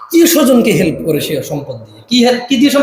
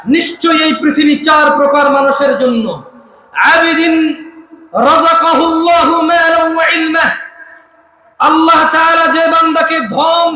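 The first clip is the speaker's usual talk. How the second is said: full-force preaching; 8 semitones higher than usual. Acted. Preacher drilling the gathering in a hadith, full-force preaching. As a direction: preach it out, calling for answers.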